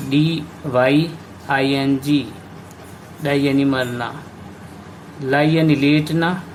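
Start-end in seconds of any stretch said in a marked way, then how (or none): 0.1-2.3 slowly spelled letter by letter; slow spelling. Shown as a demonstration. Teacher spells out the word.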